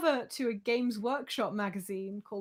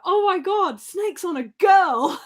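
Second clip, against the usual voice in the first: much higher and louder, delighted call; excited stranger shout; 8 semitones higher, 12 dB louder than usual. Retold or imitated. Stranger outside the cinema spots the snake-costumed friends, delighted shout. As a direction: shout it out like a thrilled passer-by. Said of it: "he was like".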